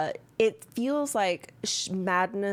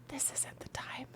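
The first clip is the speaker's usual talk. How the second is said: voiceless whisper; 7 semitones higher, 15 dB quieter than usual. Acted. GM voicing a phantom presence in a voiceless whisper. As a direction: ghostly whisper. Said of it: "a voiceless whisper saying".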